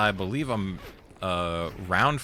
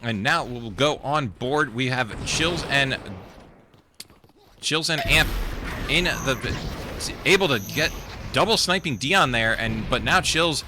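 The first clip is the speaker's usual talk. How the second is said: louder, excited esports casting; live play-by-play; 4 semitones higher, 3 dB louder than usual. Acted. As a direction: call the action with rising hype.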